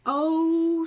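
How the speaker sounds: sudden high, loud "Oh" of dread; shocked realization. Retold or imitated. Darlene and friends realize they're next, shocked high "Oh". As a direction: gasp it out as the penny drops.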